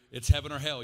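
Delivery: loud, hammered-home repeat of the ultimatum; retold scream. Friend yelling at Corey in his driveway, loud retold shout. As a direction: bellow it the second time. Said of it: "screaming at me at the top of his lungs".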